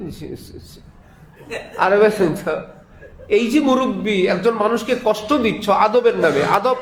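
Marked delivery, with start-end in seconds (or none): 0.1-0.6 whispered, almost soundless yes sir; too-respectful whisper that can't be heard; whispered reply. Retold or imitated. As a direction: whisper it so softly it vanishes. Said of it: "কেউ আছে কথা আস্তে বলে".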